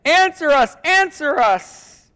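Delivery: high, loud repeated plea, drawn out at the end; insistent calling. Acted. Prophets hard at it from morning to noon, insistent calling. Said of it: "repeating again and again".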